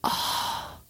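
very slow, whispery imitation; creepy Irish witch. Cohost imitating the film's line, creepy Irish witch whisper. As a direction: draw it out in an eerie whisper. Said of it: "like a creepy Irish witch".

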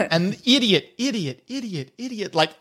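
repeated word fading like an echo. Acted. One kobold's insult carries through the chamber, echoing. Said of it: "echoes through the entire chamber".

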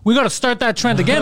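high-pitched pretend rant; mock outrage. Comedian reacting to the apology news, mock outraged. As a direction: work up a joking tirade.

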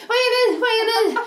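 finishing runner cheering at family; triumphant cheer. Retold or imitated. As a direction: arms up, crow it over the finish line. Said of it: "höjer händerna och så bara".

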